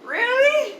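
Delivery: shrill, high falling cry of surprise; mock disbelief. Storyteller imitating the old man hearing a surprise, mock disbelief.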